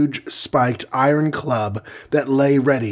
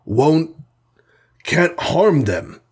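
slow, higher, with a long faltering pause; broken, halting protest; 4 semitones higher, slower than usual. A narrator's reading. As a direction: struggle to force out each word. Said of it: "he said brokenly".

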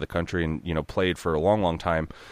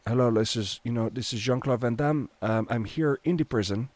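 Jean-Claude Van Damme impression; 3 semitones higher, 4 dB quieter than usual. Storyteller imitating Van Damme's French accent, quiet. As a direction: murmur it in a Belgian French accent. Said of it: "a French accent".

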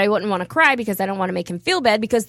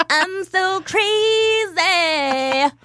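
very slow, stretched and higher; drawn-out mock; 7 semitones higher, much slower than usual. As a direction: stretch every word out in mock drama. Drawn-out self-mocking line.

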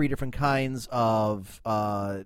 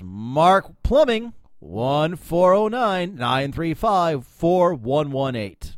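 slow reading; 4 dB louder, much slower than usual. Acted. Reading decal number aloud.

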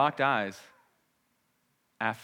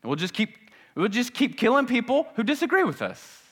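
sarcastic, higher, louder mocking voice; mock warmonger; 7 semitones higher, 6 dB louder than usual. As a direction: ham up the violent crowd with scorn. Mocking warlike humanity.

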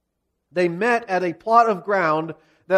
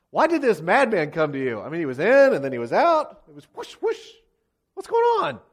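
baffled officers. Acted. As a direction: act out bewildered men, climbing to a squeak.

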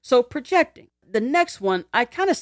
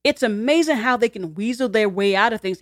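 usual speaking voice

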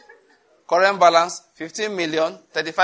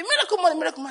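mock scream; 12 semitones higher, much faster than usual. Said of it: "They'll be screaming".

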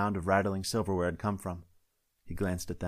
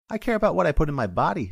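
higher and louder, offended; indignant deputy; 9 semitones higher, 7 dB louder than usual. Acted. Deputy defending her diet to the sheriff, louder and higher. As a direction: push back as if stung. Tammy insisting, hurt by questioning.

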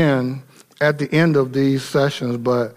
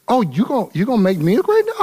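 quick, higher, lightly voiced Moses jumping at the offer; a hint of a giddy Moses grabbing the deal; mock eager Moses; 6 semitones higher, faster than usual. Preacher mocking what Moses did not say, eager and quick. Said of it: "He didn't say".

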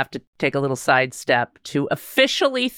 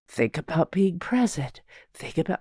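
quiet inner voice; 6 dB quieter than usual. Retold or imitated. Inner voice while meditating.

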